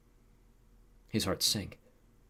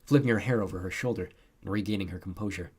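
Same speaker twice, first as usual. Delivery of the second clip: ordinary delivery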